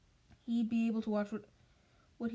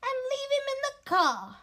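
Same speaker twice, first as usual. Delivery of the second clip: much higher, louder put-on aunt; much higher, louder fussy aunt; aunt's scheming voice; 13 semitones higher, 7 dB louder than usual. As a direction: add the solution sharply pleased. Petunia continues her plan.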